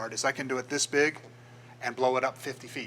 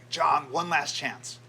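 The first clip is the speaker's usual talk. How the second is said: retold yell; 3 semitones higher, 5 dB louder, much slower than usual. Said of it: "the guy yells back".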